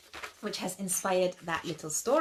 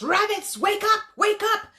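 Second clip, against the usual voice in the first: higher and louder wake-up call; roared call; 10 semitones higher, 7 dB louder than usual. Acted. The lion roars to the sleeping rabbits, calling them awake. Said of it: "he roared to the rabbits".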